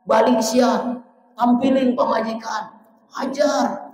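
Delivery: Satan urging the husband to strike, in a high goading voice; Satan's provocation. Retold or imitated. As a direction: goad him like a tempting devil. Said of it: "kata setan".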